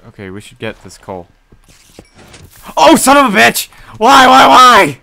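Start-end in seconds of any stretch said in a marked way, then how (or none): 2.8-5.0 alarm shout. Real, not acted. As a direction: wail in despair at the blast. Creeper blows up again.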